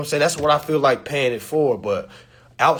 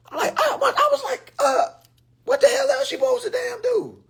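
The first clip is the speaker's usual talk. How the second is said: incredulous rant. Real, not acted. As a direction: rant high, as if they can't believe it. Exasperated rant.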